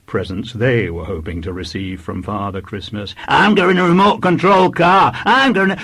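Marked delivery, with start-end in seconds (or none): 3.3-5.8 put-on high, loud clamouring child; children shouting out. A narrator's reading. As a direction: shout your wish over the other children. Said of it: "all the children to shout out".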